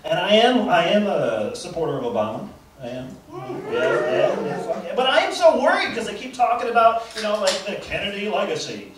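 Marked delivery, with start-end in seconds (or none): none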